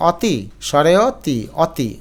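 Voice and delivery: অতি slowly, with an open 'aw'; spelling pronunciation. Shown as a demonstration. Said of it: "অতি বলি না". (অতি, খোলা অ দিয়ে ধীরে)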